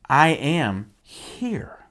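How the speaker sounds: slow, deliberate I am here; solemn slow declaration; slow, weighty. Shown as a demonstration. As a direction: let each word land with weight. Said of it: "the way he says that".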